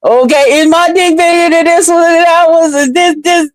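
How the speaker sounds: lightly voiced boastful dude comparing sizes; bragging guys. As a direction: imitate guys showing off and comparing. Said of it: "They like".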